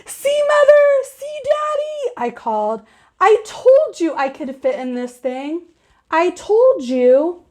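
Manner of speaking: put-on child calling to parents in the pool; thrilled calling out. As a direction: crow to your parents from the pool. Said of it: "real thrilled".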